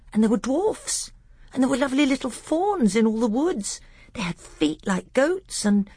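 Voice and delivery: put-on high-pitched little boy, babbling eagerly; child carried away by a favourite tale; Caspian. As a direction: enthusiastic small-boy storytelling.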